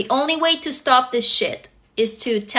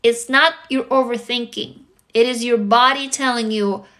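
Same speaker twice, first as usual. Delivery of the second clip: usual speaking voice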